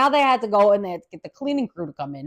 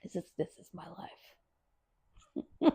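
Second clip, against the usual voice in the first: softer breathy whisper; 7 semitones lower, 17 dB quieter, much faster than usual. Real, not acted. Telling listeners her hospital life, resigned whisper. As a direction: let it fall away to a whisper. Resigned aside after the story.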